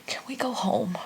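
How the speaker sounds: whispered, timid little plea; hushed, weary child voice; imitated tired child. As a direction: softly imitate a worn-out kid. Tired children asking to leave.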